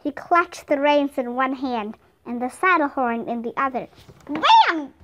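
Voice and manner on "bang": high, loud gunshot word; explosive, raised burst like a shot; voiced gunshot. A narrator's reading. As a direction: fire it out suddenly.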